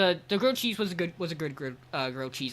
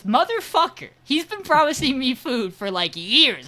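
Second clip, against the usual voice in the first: much higher, louder indignant outburst; outraged; 7 semitones higher, 7 dB louder than usual. Retold or imitated. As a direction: blurt it out, indignant at being left out. Coworker's outraged reaction retold.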